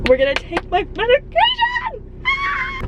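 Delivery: high, gleeful shout ending in a cry; excited shout. Real, not acted. Celebrating her new prescription to viewers, excited shout. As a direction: shout it out in triumph. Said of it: "Doing that, like, actually hurt my face".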